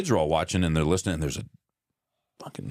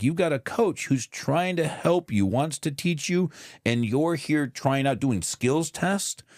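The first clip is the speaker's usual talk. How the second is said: higher-pitched retold scolding; exasperated lecture; 8 semitones higher than usual. Dad to son about his coach, exasperated lecture.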